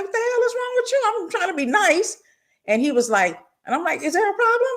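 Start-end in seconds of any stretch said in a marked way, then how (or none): 0.0-2.2 mock outraged yell. Retold confrontation in line at store. 4.0-4.7 mock confrontational challenge. Retold confrontation in line.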